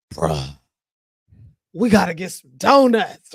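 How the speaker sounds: demon voice. Retold or imitated. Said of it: "You had to say it in the demon voice".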